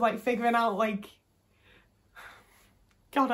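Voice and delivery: ordinary talk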